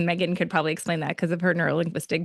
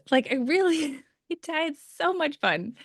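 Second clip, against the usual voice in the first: high, laughing, slower admission; laughing delight; 9 semitones higher, slower than usual. Retold or imitated. Contestant telling staff she had fun, laughing. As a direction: giggle through the joy. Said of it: "I was like".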